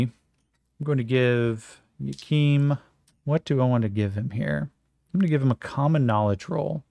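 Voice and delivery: usual speaking voice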